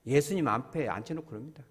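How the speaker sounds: normal talking voice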